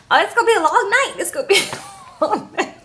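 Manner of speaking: high, fast, laughing through it; laughing dread. Real, not acted. Reacting to the awkward dinner, laughing and high. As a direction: giggle at the awkwardness ahead.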